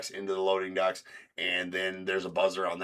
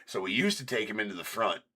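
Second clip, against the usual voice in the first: ordinary talk, nothing marked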